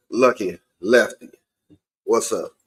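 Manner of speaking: ordinary talk, nothing marked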